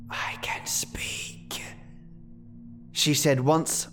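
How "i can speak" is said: very slow faint whisper; weak whisper. A narrator's reading. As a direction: whisper it slowly, with quiet pride. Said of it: "Her voice was weakened".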